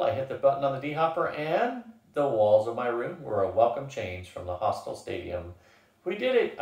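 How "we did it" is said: high, triumphant exclamation; exclaiming. A narrator's reading. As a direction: burst out in victory. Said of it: "I exclaimed, then was startled by the volume of my voice".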